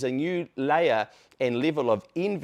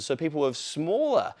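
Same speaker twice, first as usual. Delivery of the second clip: ordinary talk, nothing marked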